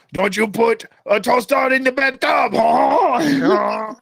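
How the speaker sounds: imitated singer. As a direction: mimic a performer, ending in a mock wail. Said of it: "That singer came on and he's like".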